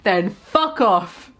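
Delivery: slow, dragged-out "fuck off"; slow dismissal. Acted. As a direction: drawl the brush-off slowly.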